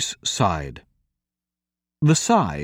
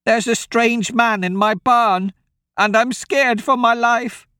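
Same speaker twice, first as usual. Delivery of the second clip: put-on frightened old woman; 11 semitones higher than usual. Old woman begs travellers for help, frightened. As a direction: plead in a high, scared old woman's voice.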